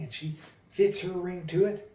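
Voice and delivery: ordinary delivery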